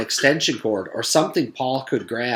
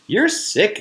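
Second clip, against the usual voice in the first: disgusted exclamation; 9 semitones higher than usual. Acted. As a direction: recoil in disgust. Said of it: "exclaimed Leslie".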